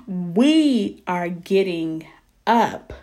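determined declaration. Retold or imitated. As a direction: stretch it out like a triumphant rallying cry. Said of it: "But I had said".

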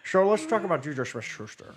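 lightly voiced cartoon grouch, slurred; Scottish accent slipping into a cartoon villain; half-laughing put-on grouch voice; Grinch. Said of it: "you sound like the Grinch".

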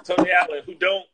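usual speaking voice

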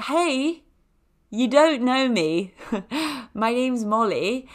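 high, slow, sheepish hello; awkward self-introduction. Acted. Applicant turning up at a stranger's door, sheepishly high.

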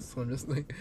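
ordinary delivery

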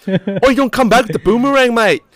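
high, loud mock-Aussie yell; exaggerated Australian bloke calling out. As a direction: shout it in a hammed-up Australian accent. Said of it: "Australians are just gonna".

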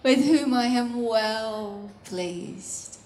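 low, slow, hushed declaration; God pleased with Son. Acted. God speaks of his Son before any miracles, low and pleased. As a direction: savour each word in a put-on fatherly voice. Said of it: "It's why he could say of the Son".

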